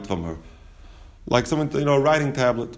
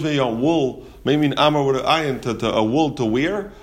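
ordinary talk, nothing marked

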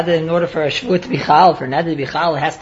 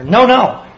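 "No, no" said higher and firmer; emphatic no; 6 semitones higher, 3 dB louder than usual. Retold or imitated. Teacher voicing a man who insists he is right, emphatic. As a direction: insist with a double "No, no". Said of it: "he emphatically says".

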